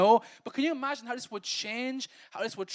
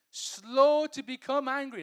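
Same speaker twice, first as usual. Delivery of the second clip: slow and loud, stretched words; drawn-out emphasis; 5 semitones higher, 8 dB louder, slower than usual. Shown as a demonstration. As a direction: hammer it home slowly. Preacher repeating verse phrase.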